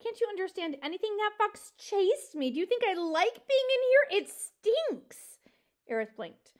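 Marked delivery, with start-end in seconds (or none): 0.0-5.4 high, loud frustrated protest; exasperated. Acted. Mouse chased into a smelly log, exasperated. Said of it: "an exasperated Poppy replied".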